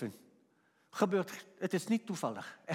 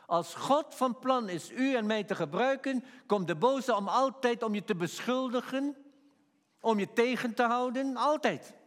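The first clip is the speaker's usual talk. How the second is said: louder, higher preaching, slowing at the end; full-force preaching; 6 semitones higher, 3 dB louder than usual. Acted. Preacher rousing the congregation, loud and high.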